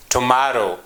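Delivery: "tomorrow" stressed on MOR; "tomorrow" as to-MOR-row, a careful word demo; stress on middle syllable. Shown as a demonstration. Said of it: "In the middle".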